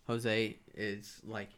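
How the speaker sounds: normal talking voice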